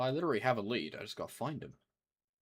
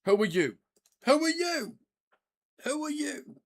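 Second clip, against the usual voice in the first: mock escalating squeak; 7 dB louder than usual. Repeated NPC greeting, rising pitch.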